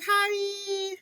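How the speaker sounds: squeaky high hi; a tiny chirped greeting; high bright hello; chirpy hi. Retold or imitated. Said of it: "I was like".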